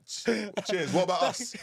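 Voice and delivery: lightly put-on hard-done-by player; half-laughing mock complaint; mock grumble to the boss. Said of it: "I'd be like".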